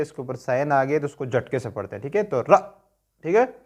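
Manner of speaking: ordinary talk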